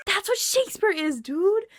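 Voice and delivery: high, loud fan outburst; overexcited fan gushing; gushing excitement. Real, not acted. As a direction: exclaim it in high-pitched awe. Gushing after the live show.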